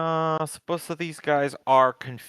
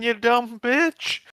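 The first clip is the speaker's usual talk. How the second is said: slow, higher, louder insult; angry outburst; 11 semitones higher, 6 dB louder, much slower than usual. Real, not acted. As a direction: spit it out in mock fury. Player insults a character.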